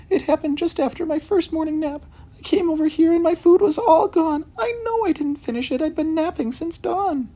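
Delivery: put-on very high, sleepy old cat voice; Missus Hudson's cat voice. Cat Missus Hudson tells detectives of her lost food, very high voice.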